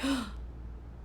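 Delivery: soft gasp of wonder, imitated; mock awed gasp. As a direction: gasp like an amazed onlooker. Imitates onlookers' awe as she enters.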